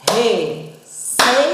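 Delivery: Receita said slowly, syllables separated; teacher reading the example word; slow sounding out. Shown as a demonstration. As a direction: spell out Receita by ear. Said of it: "O padrão silábico ficou o seguinte".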